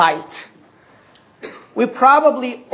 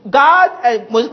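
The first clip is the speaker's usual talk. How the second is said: usual speaking voice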